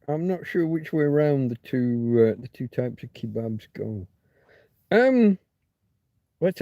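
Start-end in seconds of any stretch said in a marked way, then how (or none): none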